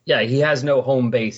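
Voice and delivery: ordinary talk, nothing marked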